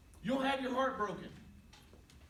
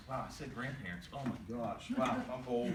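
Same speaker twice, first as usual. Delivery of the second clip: much lower, muttered-to-yourself aside; mock dismay; 11 semitones lower, 4 dB quieter than usual. Speaker realising he said grandparents, mock dismay. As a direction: drop low for a mock-shocked aside.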